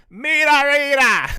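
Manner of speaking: very high, loud shouted catchphrase; Kevin Sullivan shout. Retold or imitated. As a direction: bellow it like a wild wrestling promo. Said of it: "as Kevin Sullivan would say".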